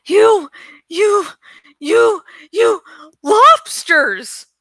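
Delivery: high, loud stammering in fear; terrified stammer. Acted. Woman stammers in terror at the morphing boys. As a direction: force the words out through panic. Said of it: "she managed to say".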